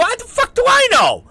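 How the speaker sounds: high, loud incredulous yell; exasperated yell. Retold or imitated. Snapping at his wife over her cover story, exasperated yell. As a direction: throw it back at her, fed up.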